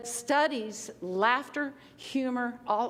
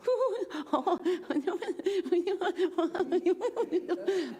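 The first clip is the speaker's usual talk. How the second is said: high giggly nervous laugh; 8 semitones higher than usual. Shown as a demonstration. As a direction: giggle like meeting your idol. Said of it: "then we have the nervous laughter".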